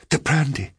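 gasped. A narrator's reading. Cowering woman begs the man for brandy, gasped. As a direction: plead for it with a mild gasp. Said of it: "she gasped".

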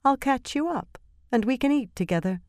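high, sweet, lilting promise; high, lovely voice. A narrator's reading. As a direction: lift it high, almost singing. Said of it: "Her voice was high and lovely".

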